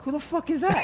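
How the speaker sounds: high, startled laughing cry; mock shock at a stranger bursting in; startled yell. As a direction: blurt it out half laughing. Startled yell at door kicker.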